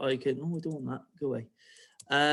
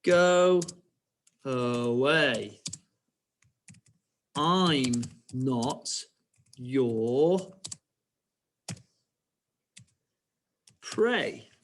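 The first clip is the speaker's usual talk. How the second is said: word by word with long pauses; stretched words, long gaps between; slow dictation; much slower than usual. Shown as a demonstration. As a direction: dictate very slowly for pupils writing along. Same line dictated slowly for writing.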